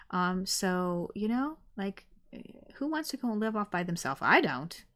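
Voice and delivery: ordinary delivery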